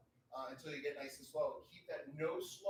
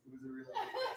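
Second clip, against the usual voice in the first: laughing repeat; 9 semitones higher than usual. Real, not acted.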